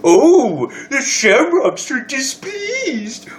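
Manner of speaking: very high, drawn-out mock dismay; mocking sympathy. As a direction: stretch it into a squeaky, swooping taunt. Mocking the displeased team.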